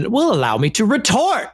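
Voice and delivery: high, booming movie-quote delivery; Sam Jackson in Pulp Fiction. Acted. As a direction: declaim it like a famous film line. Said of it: "the Sam Jackson in Pulp Fiction".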